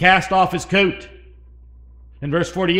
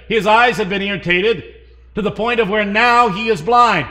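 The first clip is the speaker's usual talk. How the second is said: preaching; 5 semitones higher, 5 dB louder than usual. Acted. Preaching at full force.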